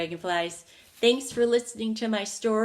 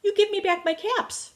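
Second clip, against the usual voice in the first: high, louder, finger-wagging scold; scolding peddler; 11 semitones higher, 6 dB louder than usual. A narrator's reading. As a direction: tell the monkeys off, pitched up. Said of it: "he shook his little finger at him".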